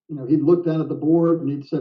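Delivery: ordinary talk, nothing marked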